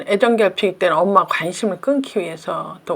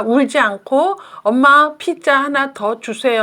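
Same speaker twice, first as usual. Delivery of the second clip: slow, higher, deliberate model phrase; firm modelling; 4 semitones higher, 3 dB louder than usual. Shown as a demonstration. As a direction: spell it out firmly for a child to copy. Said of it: "그때 엄마가 단호하게". (단호하게 또박또박 시범 보이기)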